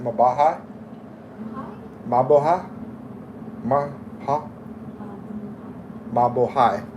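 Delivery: feeling out Mabaha, Maboha, Mabohai syllable by syllable; trying to pronounce. Shown as a demonstration. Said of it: "how do you say it?".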